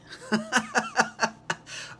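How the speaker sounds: big laugh. Real, not acted. Laughing at own joke.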